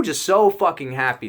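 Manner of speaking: normal talking voice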